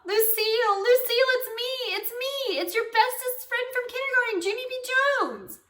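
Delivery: put-on very high, excited child's cry; joyful squeal. A narrator's reading. Girl hugging her best friend, joyful squeal. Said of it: "I shouted real joyful".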